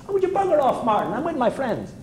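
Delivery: high, dismissive snap at Martin; lightly voiced Billy telling Martin to bugger off; mock brush-off. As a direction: wave off Martin like a pest, pitched high.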